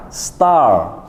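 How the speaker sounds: star with drawn-out A; long A in star. Shown as a demonstration. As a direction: hold the A in star. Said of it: "bacaannya agak panjang sedikit".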